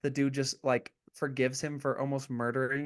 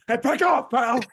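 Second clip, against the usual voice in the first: tough shout; 9 semitones higher, 6 dB louder than usual. Retold or imitated. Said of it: "he's like".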